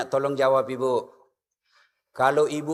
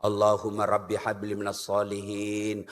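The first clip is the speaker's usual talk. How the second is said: put-on soft prayer to child; 10 semitones lower, 7 dB quieter than usual. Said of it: "usap-usap kepalanya".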